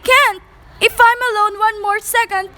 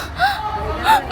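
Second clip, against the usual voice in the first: sharp, high gasp of alarm; a startled gasp as she realises the clock stopped; frightened gasp; 11 semitones higher, 4 dB quieter than usual. Acted. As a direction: catch your breath in sudden fright. Clock stopped, woman panics.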